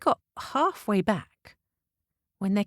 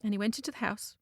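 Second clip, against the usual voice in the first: ordinary delivery, nothing marked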